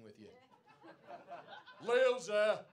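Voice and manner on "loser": loud, high taunt; high, loud heckler put-down; jeering shout. Acted. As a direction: yell it across the club as a jeer. Calls out insult at heckler.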